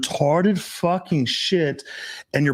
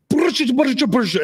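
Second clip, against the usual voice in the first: high-pitched mock Russian scolding; imitated loud Russian; 12 semitones higher, 3 dB louder than usual. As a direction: imitate an angry girlfriend's rapid gibberish Russian. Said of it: "I've never heard her speak so loud".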